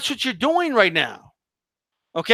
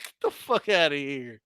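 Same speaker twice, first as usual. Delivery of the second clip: ordinary talk, nothing marked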